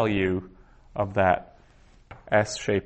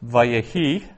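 Vayehi with the last syllable, HI, stressed; stress on final syllable; 3 semitones higher, 6 dB louder than usual. Shown as a demonstration. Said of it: "I accent that last syllable".

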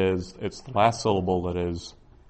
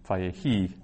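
Vayehi with HI stressed; stress on final syllable. Shown as a demonstration. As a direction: lean on the last syllable of Vayehi. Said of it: "which syllable you put the stress on".

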